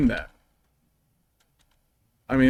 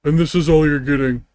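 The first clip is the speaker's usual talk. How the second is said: loud cartoon character voice; Patrick Star; 2 semitones higher, 6 dB louder than usual. Shown as a demonstration. Streamer giving viewers the same line once more, as Patrick Star. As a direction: belt it out as a cartoon starfish. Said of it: "but that's my Patrick voice".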